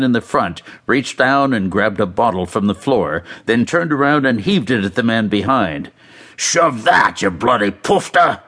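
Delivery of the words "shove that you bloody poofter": a touch of an angry fan's yell; mild acted yell. A narrator's reading. Fan heaves a bottle at his rival, mild angry yell. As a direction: yell the insult lightly, in character. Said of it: "he yelled".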